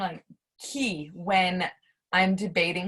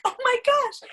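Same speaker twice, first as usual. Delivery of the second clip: much higher, thrilled exclamation; excited gush; 4 dB louder than usual. Retold or imitated. New believer telling everybody about Jesus, excited gush. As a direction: gush it like brand-new news.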